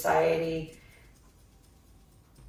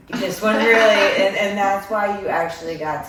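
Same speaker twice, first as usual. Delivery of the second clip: laughing; 4 semitones higher, 13 dB louder than usual. Real, not acted. Reader laughs mid-reading.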